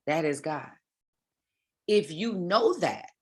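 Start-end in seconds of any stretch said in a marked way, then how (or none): none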